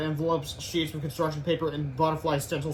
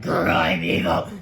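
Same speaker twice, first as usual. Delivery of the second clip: much lower, slow, louder evil growl; evil villain voice; 11 semitones lower, 6 dB louder, slower than usual. Acted. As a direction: play a cartoon villain. Villain voice in comic.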